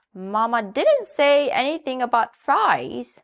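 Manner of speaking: a bright, clucking hen; Nanny Hen. A narrator's reading. Hen greets the little donkey in a high voice. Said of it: "said Nanny Hen".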